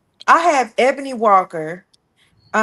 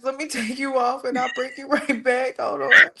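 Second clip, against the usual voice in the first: ordinary talk, nothing marked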